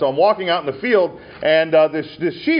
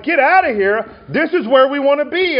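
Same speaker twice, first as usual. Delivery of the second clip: higher, louder defiant brush-off; stubborn sheep; 12 semitones higher, 6 dB louder than usual. Retold or imitated. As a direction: shove the shepherd away, stubborn. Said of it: "the sheep are like".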